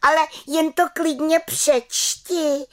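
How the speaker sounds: very high, slow defiant exclamation; crying out. A narrator's reading. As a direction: cry out a drawn-out challenge as the little witch. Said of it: "vykřikla malá čarodějnice". (vzdorný táhlý výkřik vysokým hlasem)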